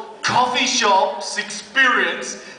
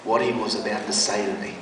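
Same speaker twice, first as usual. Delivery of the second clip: lower, softer, weighty; preacher building suspense about life-changing words; solemn build-up; 11 semitones lower, 5 dB quieter than usual. A narrator's reading. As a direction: hush it for the big moment.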